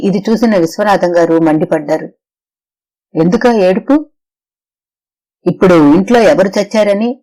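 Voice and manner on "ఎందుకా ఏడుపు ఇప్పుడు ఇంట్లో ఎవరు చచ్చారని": a mildly raised, narrated shout; a stern father lightly raising his voice. A narrator's reading. Father scolding his crying daughter, in a mild narrated shout. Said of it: "అని అరిచారు".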